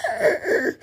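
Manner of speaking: very high strained squawk; mock rooster crow. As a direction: squeeze out a young rooster's first crow. Imitating rooster's first crow.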